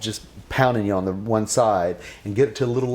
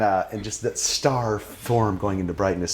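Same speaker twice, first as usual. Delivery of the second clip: ordinary delivery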